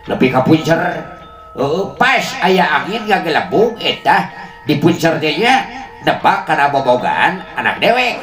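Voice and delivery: usual speaking voice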